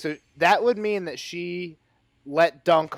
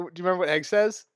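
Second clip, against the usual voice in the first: usual speaking voice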